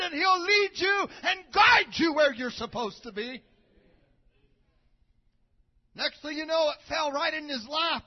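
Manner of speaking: loud, high revival preaching; preaching at full force. Acted. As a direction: drive it home like a revival preacher. Preaching builds.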